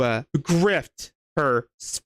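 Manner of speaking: ordinary delivery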